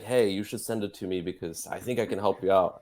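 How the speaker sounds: ordinary talk, nothing marked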